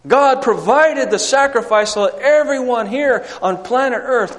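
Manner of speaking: loud, fast, high sermon climax; fiery preaching. Acted. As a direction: preach it out with full force. Preaching at full force.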